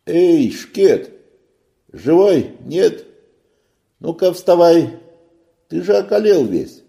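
loud, high calling out; shouting sailor. A narrator's reading. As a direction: yell to wake him up. Sailor shouting to wake boy.

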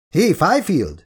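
high-pitched sharp call; shouting after someone. A narrator's reading. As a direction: call out to stop them. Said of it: "kiáltott rá Shaw doktor".

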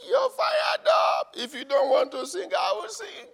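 high shouted preaching; fired-up shout. Real, not acted. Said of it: "preacher shouting fired up".

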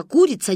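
mock the word "курица" up high; mocking imitation. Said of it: "передразнивала она меня".

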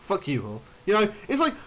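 ordinary delivery

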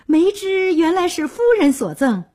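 high-pitched, overjoyed realisation; delighted surprise. A narrator's reading. Groom learning his bride gave the branch, overjoyed surprise. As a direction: exclaim it in happy amazement. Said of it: "张秀林万分惊喜".

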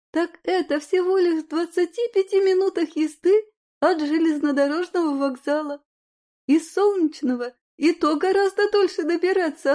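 put-on high, eager, coaxing girlfriend; persuasive friend. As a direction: talk her into it cheerfully. Lyudmila persuading her friend.

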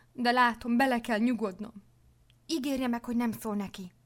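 a touch of despair, read in character; anxious pleading. A narrator's reading. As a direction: plead for a promise with understated distress. Frightened nun begs for a promise.

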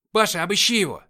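high, loud sergeant's order; командный крик. A narrator's reading. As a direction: shout an order to your private. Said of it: "скомандовал парень с сержантскими погонами". (громкая команда сержанта)

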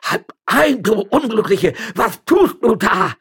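high panicked shout, drawn out at the end; terrified cry. A narrator's reading. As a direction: cry out as if scared to death. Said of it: "rief der Stadtrichter zu Tode erschrocken".

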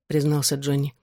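ordinary delivery

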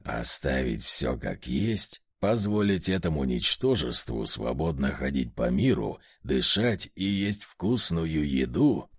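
низкий медленный злобный голос; смакуй угрозу низко и медленно; зловещий демон. A narrator's reading. Одержимый душит Власова, грозит. (низкий злобный голос одержимого)

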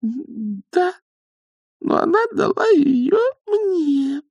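very high, slow whine of protest; squeaky insistence. Acted. Young witch refuses to give up wand, squeaking. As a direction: insist in a tiny stretched squeak.

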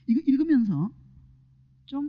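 ordinary talk, nothing marked

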